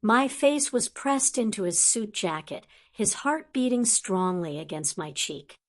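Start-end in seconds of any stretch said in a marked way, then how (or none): none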